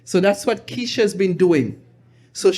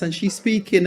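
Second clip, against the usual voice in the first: ordinary talk, nothing marked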